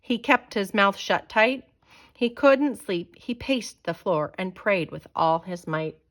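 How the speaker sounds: plain reading voice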